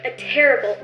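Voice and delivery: normal talking voice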